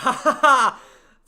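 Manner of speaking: sudden high, very loud laugh. Real, not acted. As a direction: burst out laughing at full volume. Bursts out laughing mid-read.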